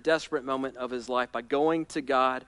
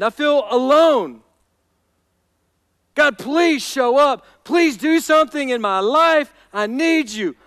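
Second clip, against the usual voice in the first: loud, imploring prayer; anguished plea; 11 semitones higher, 9 dB louder than usual. Acted. David telling God he feels abandoned and alone, pleading. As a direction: cry out in acted hurt, pausing between appeals. Voices David crying out in pain.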